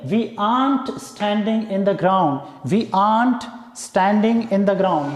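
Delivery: each word spaced out slowly; slow careful demo. Shown as a demonstration. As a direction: dictate it word by word for learners. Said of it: "contraction form में कैसे बोलेंगे". (धीरे-धीरे साफ़ बोलकर)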